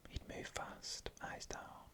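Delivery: ordinary delivery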